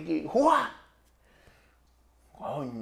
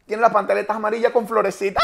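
ordinary delivery

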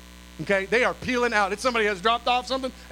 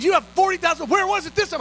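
amazed; 8 semitones higher, 5 dB louder, faster than usual. Retold or imitated. Contractor amazed at money.

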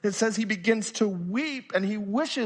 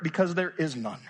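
usual speaking voice

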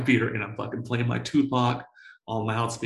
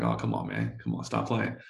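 low, softer mock plea to the clerk; pleading with clerk; 12 semitones lower, 5 dB quieter than usual. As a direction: drop the voice and beg half-jokingly.

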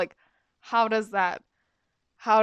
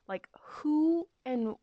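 whispered; 5 semitones higher, 5 dB quieter, slower than usual. Retold or imitated. Headmistress interrogating the silent class.

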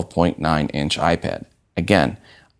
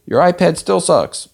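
much higher-pitched jeering crowd voice; mock audience heckle; 11 semitones higher, 3 dB louder than usual. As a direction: heckle the presenter, acted out. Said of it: "The audience says".

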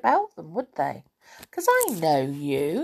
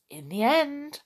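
plain reading voice

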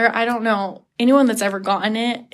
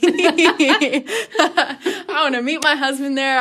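slow, higher, laughing through it; giggly slow joke; laughing confession; 5 semitones higher, much slower than usual. Retold or imitated. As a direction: admit it while giggling. Joking about finding husband.

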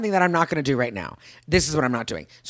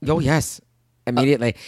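ordinary talk

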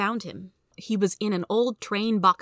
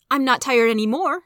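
put-on higher, louder boy's retort; defiant boy; 6 semitones higher, 7 dB louder than usual. A narrator's reading. Son answering his father back, defiant. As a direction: push back loudly, pitched up.

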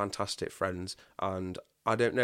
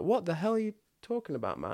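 much higher, baffled demand; incredulous; 9 semitones higher, faster than usual. Retold or imitated. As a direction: question him in disbelief. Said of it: "then I was quite pumped".

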